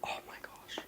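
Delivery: tiny breathless whisper. Real, not acted. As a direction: whisper it in awe. Opening Bible first time.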